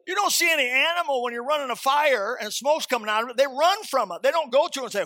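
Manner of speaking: high, loud sermon delivery; forceful preaching. Real, not acted. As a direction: drive the point home to the congregation. Preaching against smoking.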